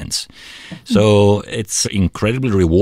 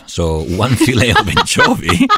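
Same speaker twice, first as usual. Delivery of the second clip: talking through a real laugh; a laugh breaking into the words; amused, laughing while explaining the anchovy; 5 semitones higher than usual. Real, not acted. Laughs mid-sentence.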